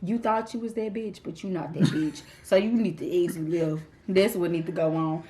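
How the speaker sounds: low, muttered inner put-down. Retold or imitated. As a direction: grumble it under your breath to yourself. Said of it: "So in my head".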